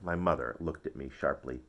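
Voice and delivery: ordinary delivery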